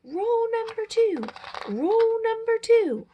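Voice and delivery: high, loud shouted warning; shouting. Acted. Steve shouting the rule at his hose handlers, loud and high. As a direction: bellow the rule like an alarm to the kids. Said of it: "especially when Steve is shouting".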